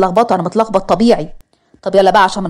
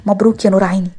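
ordinary delivery, nothing marked

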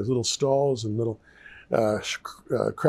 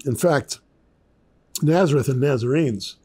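usual speaking voice

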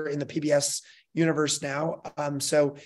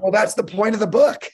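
higher, louder mock protest; exasperated retort; 6 semitones higher, 7 dB louder than usual. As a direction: push back in comic exasperation. Retells objecting to publisher's demand.

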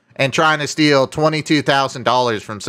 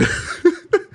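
high laugh; 13 semitones higher than usual. Real, not acted. Laughing at the scammer's silence.

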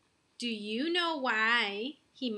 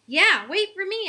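higher, louder yell; 8 semitones higher, 8 dB louder than usual. A narrator's reading. Boy yells to sister leaving for the bus, yelling. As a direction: shout after your sister. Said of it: "I yelled".

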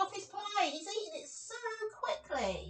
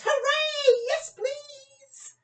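high, loud cheer; excited cheer; 9 semitones higher, 5 dB louder, much slower than usual. Acted. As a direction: cheer like a greedy monster. Max cheers at picnic.

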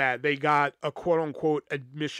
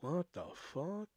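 stunned; 13 dB quieter, slower than usual. Retold or imitated. Stunned group reaction to accusation.

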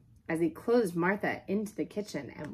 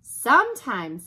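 loud, raised, drawn-out word; fed-up outburst; 5 semitones higher, 12 dB louder, slower than usual. Acted. Girl to the talkative dog, fed up and loud. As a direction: come in hard and exasperated. Helen joins the scolding.

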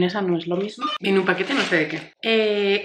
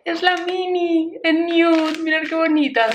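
excited; 7 semitones higher than usual. Real, not acted. Gushing over a gift.

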